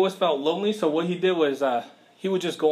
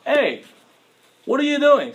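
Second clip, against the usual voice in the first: loud, higher call of God stopping someone; 9 semitones higher, 6 dB louder than usual. Acted. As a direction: shout it out like God catching you mid-mistake. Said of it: "God says through Isaiah".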